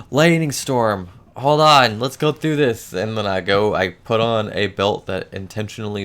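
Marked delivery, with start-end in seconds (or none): 0.0-2.9 urgent, raised and high; hurried call to hold on; excited. Retold or imitated. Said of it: "I'm like".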